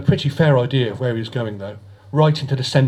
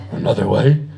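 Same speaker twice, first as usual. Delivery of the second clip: puzzled rumble; 4 semitones lower than usual. A narrator's reading. Asbo puzzled by Decker's idea, lower rumbling question. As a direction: rumble it back as a dull question.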